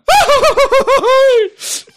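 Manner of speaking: high, loud villainous laugh; maniacal laugh shout. Acted. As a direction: cackle like a madman chasing someone. Chasing gardener off stage.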